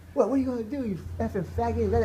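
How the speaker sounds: high-pitched mimicked goading; taunting wife. Wife in husband's face, imitated as high, taunting provocation. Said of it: "talking to me like that".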